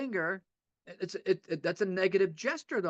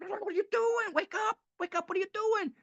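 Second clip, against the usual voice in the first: much higher, shaking someone awake; urgent wake-up call; 13 semitones higher than usual. Retold or imitated. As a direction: grab him by the shirt and snap him out of it. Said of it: "I wanna grab people and go".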